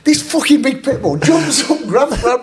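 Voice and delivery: high-pitched, incredulous storytelling; excited, laughing climax. Real, not acted. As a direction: tell it cracking up at the chaos.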